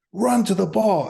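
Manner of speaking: high, loud command; retold yell. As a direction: bark the order across the field. Teammate yelling instruction.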